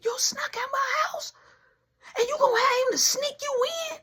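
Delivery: high, fast incredulous grandma; outraged grandma. Retold or imitated. Nana scolding the grandson, high and incredulous. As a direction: pile on the disbelief.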